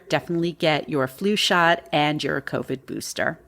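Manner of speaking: ordinary delivery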